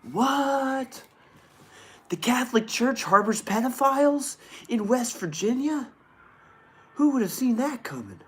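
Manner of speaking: high, loud fake astonishment; mock shock. Streamer reading a church abuse headline, sarcastic mock shock. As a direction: gasp at the news as if it's a total surprise.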